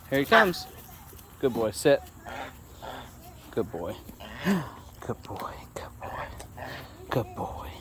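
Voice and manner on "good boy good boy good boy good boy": hushed whispered praise. Real, not acted. Whispering praise to the dog, hushed. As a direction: breathe it so you don't startle him.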